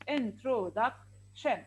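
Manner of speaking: "introduction" slowly broken into four parts; syllable by syllable. Shown as a demonstration. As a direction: read "introduction" as in, tro, duc, tion. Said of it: "When you read it".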